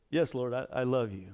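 low, soft, faltering reply; subdued answer. Retold or imitated. Peter answering Jesus's question about love, subdued. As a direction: answer humbly under your breath.

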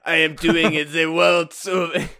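a hint of a drunken French accent; slow, lazy mock French. Host doing a bad French accent for the joke. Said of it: "except for it's French".

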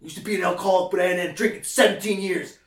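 lightly voiced intense veteran type; intense New York guy.

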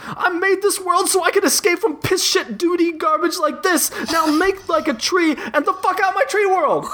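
high, shouting monster voice; angry blob shouting. Acted. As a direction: rant furiously as the tree blob. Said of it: "The blob is still shouting".

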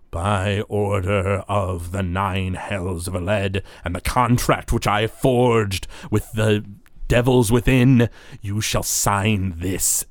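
slow, formal decree; Zalaman the Wretched. Acted. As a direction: pronounce a binding order, drawing out the words. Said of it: "Zalaman the Wretched sort of stands up".

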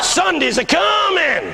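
high-pitched, drawn-out preacher's shout; preaching picking up. Retold or imitated. Old pastor building his sermon, fervent proclamation. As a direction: proclaim it slowly, ringing out. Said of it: "beginning to pick up now".

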